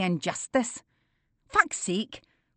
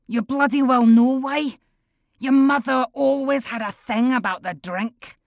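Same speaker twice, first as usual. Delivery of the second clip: harsh; 5 semitones higher, 6 dB louder than usual. A narrator's reading. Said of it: "his voice harshening".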